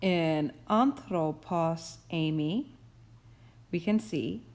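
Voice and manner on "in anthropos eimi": "anthropos eimi" read slowly and carefully syllable by syllable; Greek example read aloud. Shown as a demonstration. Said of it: "we can look at a couple of examples".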